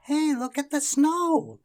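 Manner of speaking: put-on high, loud, slow children's cry; excited children crying out. A narrator's reading. Children spotting the first snow, crying out. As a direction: shout with joy at the snow. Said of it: "cried the children".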